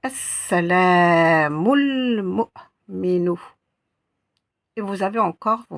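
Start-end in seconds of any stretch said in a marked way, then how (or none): 0.0-3.4 "As-salamul mu'minu" read very slowly and carefully; a teacher modelling each syllable slowly; slow model reading. Shown as a demonstration. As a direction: lecture modèle très lente. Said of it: "Je vous lis maintenant les deux valeurs".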